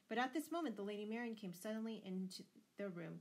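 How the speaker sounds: ordinary delivery, nothing marked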